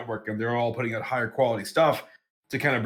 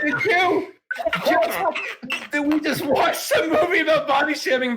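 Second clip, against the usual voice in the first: much higher, disbelieving squeal; high incredulous; 13 semitones higher, 4 dB louder than usual. Retold or imitated. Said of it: "I'm like".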